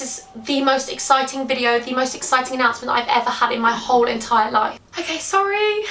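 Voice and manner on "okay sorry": high, tearful apology; crying. Real, not acted. Vlogger apologising to viewers for crying, tearful. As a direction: apologise through real tears, voice pitched right up. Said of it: "Sorry, I can't stop crying".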